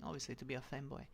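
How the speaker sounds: usual speaking voice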